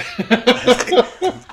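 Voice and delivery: loud squealing laugh; delighted squeal of laughter; high burst of laughter. Real, not acted. Laughing at friend's reaction.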